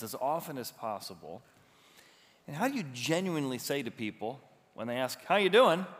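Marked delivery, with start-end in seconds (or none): 5.2-5.9 bright, loud hello; loud cheery greeting. Retold or imitated. Greeting asked of people.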